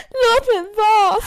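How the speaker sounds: high, loud, slow sob-choked plea; dramatic crying. Retold or imitated. Girl retelling breakup, sobbing at boyfriend to leave her alone. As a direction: wail it like a heartbroken teen in a melodrama.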